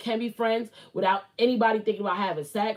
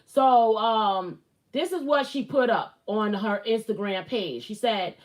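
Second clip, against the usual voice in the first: ordinary talk, nothing marked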